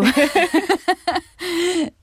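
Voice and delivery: high-pitched giggling; laugh. Real, not acted. Actress cracks up after exclaiming over Marta, high laughter.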